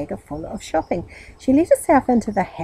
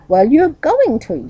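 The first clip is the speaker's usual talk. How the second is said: higher, louder firm reply; stern retort; 7 semitones higher, 6 dB louder than usual. A narrator's reading. Aunt overruling Meddle, stern. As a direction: shut down the excuse.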